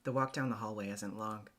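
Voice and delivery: ordinary delivery, nothing marked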